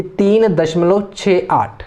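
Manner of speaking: slow "छः, आठ" as separate digits after दशमलव; Hindi digit-by-digit reading. Shown as a demonstration. Teacher showing Hindi-medium students, digit by digit. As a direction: space out "छः" and "आठ" slowly. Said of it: "ऐसे पढ़ना है".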